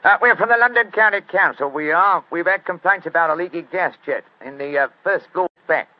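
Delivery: loud, high Cockney workman; brash Cockney council man at the door; Cockney accent. Acted. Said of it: "My Cockney accent".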